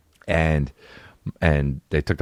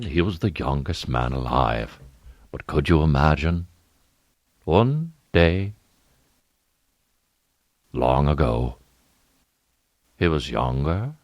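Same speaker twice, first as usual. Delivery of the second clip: mock narrator; slower than usual. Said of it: "mock epic narrator".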